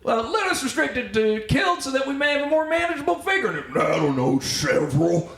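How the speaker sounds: mumbled courtroom exchange, pitched high; hammed-up, barely intelligible Western impression; Jeff Bridges. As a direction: chew the words into a comic mumble. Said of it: "indecipherably, like, mumbled in that show".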